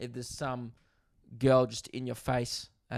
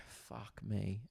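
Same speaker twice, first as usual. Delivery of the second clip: muttered despair; 3 semitones lower, 12 dB quieter than usual. Retold or imitated. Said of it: "I go".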